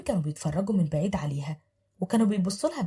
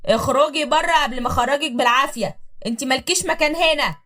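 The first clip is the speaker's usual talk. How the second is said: loud, raised threat; shouting husband; 6 semitones higher, 6 dB louder than usual. Acted. Husband shouting at wife to get out. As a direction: yell her out the door. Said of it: "بيزعق فيهم جامد".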